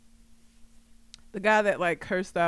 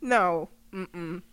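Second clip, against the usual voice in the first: usual speaking voice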